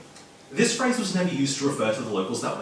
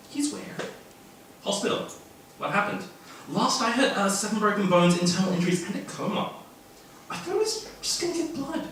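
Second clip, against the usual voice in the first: gossiping students; 7 semitones higher than usual. Acted. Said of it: "rumours had begun circulating".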